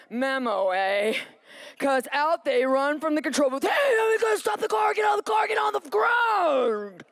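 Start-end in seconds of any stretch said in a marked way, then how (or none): 3.6-7.0 cops shouting. Retold or imitated. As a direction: yell it like a cop ordering you out. Cops shouting orders at car.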